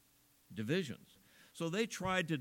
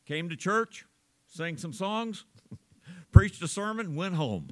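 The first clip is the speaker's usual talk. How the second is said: mock terse summary, each bit clipped off; clipped short phrases; 3 semitones higher, 7 dB louder than usual. Shown as a demonstration. Preacher mimics terse talk to congregation, clipped and curt. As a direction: tick off the week in curt little bursts, a laugh breaking in. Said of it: "we just say short things".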